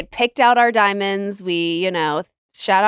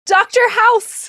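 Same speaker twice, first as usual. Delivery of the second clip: 1 excited; 11 semitones higher, 4 dB louder, slower than usual. Real, not acted. Excited recognition of star.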